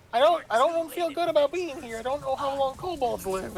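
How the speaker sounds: high-pitched worried character voice; voiced game character. Game character uneasy about being held here, high put-on voice. Graw's acted reply in retold scene.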